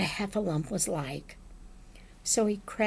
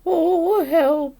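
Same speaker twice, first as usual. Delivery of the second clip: loud, higher plea from inside a jar; mildly distressed bear, pitched up; Pooh stuck in jar; 7 semitones higher, 8 dB louder than usual. A narrator's reading. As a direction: moan for help, head stuck. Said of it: "he said inside the jar".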